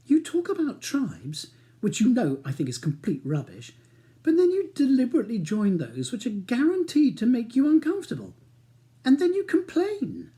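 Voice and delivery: wife berating husband, exasperated. Acted. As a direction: scold him with mounting exasperation. Wife's exasperated tirade.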